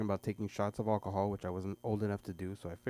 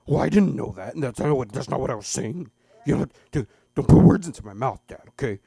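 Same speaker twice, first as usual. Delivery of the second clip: louder, indignant protest; mock defensive kid; 3 semitones higher, 15 dB louder than usual. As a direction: push back at dad. Son defensive.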